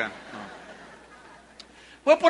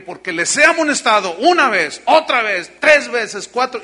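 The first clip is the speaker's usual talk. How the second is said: forceful preaching; 3 semitones higher, 8 dB louder than usual. Real, not acted. Preacher stresses God's warnings.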